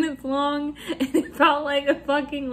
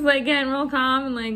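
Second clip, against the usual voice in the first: normal talking voice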